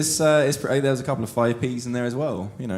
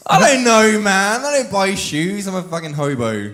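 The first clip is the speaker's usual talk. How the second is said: louder and higher, flustered protest; exasperated outburst; 8 semitones higher, 9 dB louder than usual. Real, not acted. Answering the cobbler's shoe question, loud flustered protest. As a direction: blurt it out, caught off guard.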